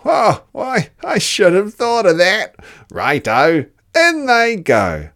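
high, loud, cheeky Dingo; a greedy dog egging the others on. Acted. Dingo cheers Platypus tipping mud in.

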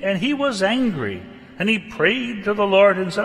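loud, high, earnest Bible reading; scripture reading. Acted. Preacher reading Jonah aloud to the congregation, loud and solemn. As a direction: proclaim the passage to the congregation.

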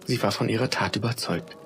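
usual speaking voice

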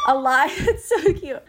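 squealing with giddy laughter; laughing. Real, not acted. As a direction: gush through laughter. Gushing over a sweet scene.

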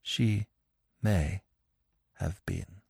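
each word dragged out in grief; brokenly. Acted. Widow admitting the affair, quiet and broken. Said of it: "said brokenly".

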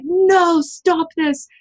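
sharp high cry of protest; a pleading retold no; aghast. Recalling her inner protest at the panel, aghast and high. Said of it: "And I was just aghast".

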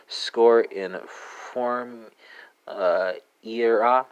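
"skor inform era" said very slowly in Swedish; slow Swedish demo. Shown as a demonstration. Teacher slowly modelling "skor informera" for Swedish learners. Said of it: "let's go from Norwegian to Swedish".

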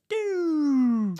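a high, loud imitated police siren whoop; siren noise. A high imitated siren as the cop pulls up beside the car.

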